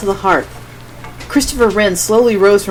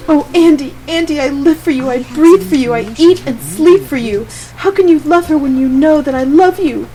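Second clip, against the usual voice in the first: weeping plea; 8 semitones higher, 5 dB louder than usual. Acted. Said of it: "She wept bitterly".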